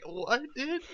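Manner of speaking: high, loud incredulous question; baffled disbelief. Retold or imitated. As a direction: squawk it in mock disbelief. Baffled reply to teacher's summons.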